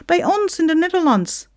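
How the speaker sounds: put-on high, insistent Dutch-accented retort. Sinterklaas answers the complaining Santa, Dutch accent. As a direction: pipe up loudly in a Dutch accent.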